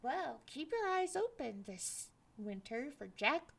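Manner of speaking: plain reading voice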